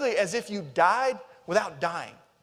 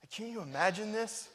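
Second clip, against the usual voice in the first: ordinary delivery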